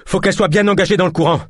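high, fast, strained urgency; panting. A narrator's reading. De Lune explains while pushing the boat, panting. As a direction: rush it out while short of breath. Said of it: "haleta-t-il".

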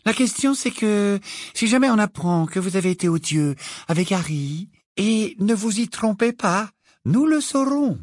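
a kind tone hiding a threat; pleasantly menacing. A narrator's reading. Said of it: "d'un ton aimable".

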